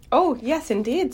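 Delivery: high, eager reply; delighted agreement. Acted. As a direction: answer a new friend with delight. Delighted at seeing new friend.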